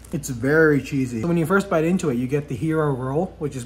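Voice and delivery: talking while chewing; words muffled by food; mouth partly full. Real, not acted. Seen 4: bites at -1.0, then speaks with pursed, busy mouth until ~3.5.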